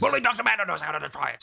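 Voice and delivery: very fast, insistent cut-in; rushed mock correction; hurried interruption. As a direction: rattle it off over the other person. Gray Fox cuts Snake off.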